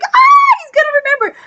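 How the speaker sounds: very high, loud squeal of delight; squeal, then gush in a high voice; fangirl squeal. Retold or imitated. Acting out a moved viewer.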